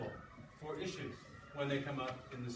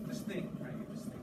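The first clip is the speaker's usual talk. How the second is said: hushed prompt; 3 semitones lower than usual. Real, not acted. Teacher hushes class to reflect.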